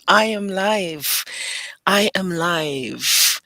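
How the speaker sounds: slow, loud, spelled out; drawn-out announcement to the camera; slow declaration. Real, not acted. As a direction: stretch each word out. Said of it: "drawn-out repeated declaration".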